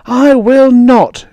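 very high, loud, slow refusal; furious drawn-out shriek; screaming. A narrator's reading. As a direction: scream it in open defiance. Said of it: "she screamed".

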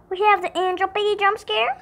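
usual speaking voice

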